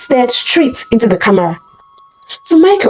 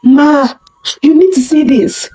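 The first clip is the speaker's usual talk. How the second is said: shaky voice; 6 semitones higher than usual. A narrator's reading. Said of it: "Her P A's voice was shaky".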